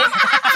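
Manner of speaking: shrieking laugh; real shriek of laughter; high burst of laughter. Real, not acted. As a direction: let out a sudden high laugh. Laughs at joke.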